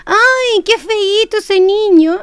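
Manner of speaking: put-on high, slow, sing-song baby talk; sweet affectionate tone. Shown as a demonstration. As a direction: coo it tenderly to the child. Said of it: "cambia totalmente el sentido".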